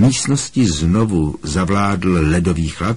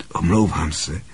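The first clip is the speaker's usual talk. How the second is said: lightly mumbled, a little softer; mumbled apology; 2 semitones lower, 4 dB quieter than usual. A narrator's reading.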